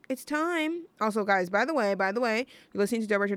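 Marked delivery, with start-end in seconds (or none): 0.1-0.9 Alice's line. Retold or imitated. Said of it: "you know, when Alice goes".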